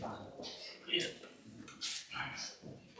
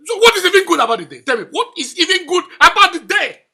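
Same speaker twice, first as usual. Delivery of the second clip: high, loud angry challenge; angry shouting; 10 semitones higher, 17 dB louder than usual. Acted. Father throwing a greeting back at the greeter, angry shouting. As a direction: demand an answer, voice raised and pitched up.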